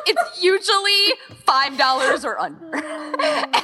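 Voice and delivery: self-mocking giggle; laughing. Real, not acted. Organizer confessing cheap wine habit, laughing. Joking about cheap Moscato.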